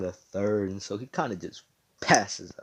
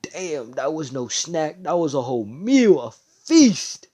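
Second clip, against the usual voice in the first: delighted villain; 6 semitones higher, 9 dB louder than usual. Acted. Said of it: "he's like".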